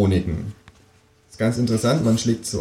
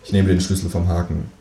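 ordinary delivery

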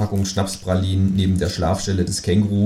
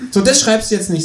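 much higher kangaroo voice with a laugh in it; 13 semitones higher, 4 dB louder than usual. Acted. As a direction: tease him, giggling, high. Känguru dialogue.

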